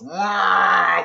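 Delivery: loud, drawn-out "wow" like big wings; mock vulture whoosh; swooping vulture noise. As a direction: imitate a big bird sweeping in. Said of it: "and goes".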